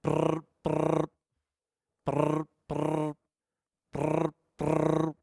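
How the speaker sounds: trilled phone ring, repeated in pairs; imitated phone ringing. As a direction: roll out a ringing-phone noise. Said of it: "the phone's going like".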